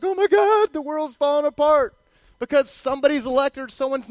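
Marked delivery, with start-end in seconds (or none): 0.0-1.9 much higher and louder, hammed-up despair; mock panicked wail. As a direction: wail it like the world is ending. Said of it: "crying like".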